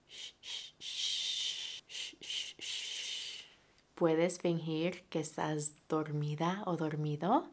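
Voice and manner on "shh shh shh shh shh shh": soft whispered shh; shush. Shown as a demonstration. Teacher shows the kids how to hush, whispered. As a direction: hush them gently. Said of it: "tenemos que estar muy calladitos".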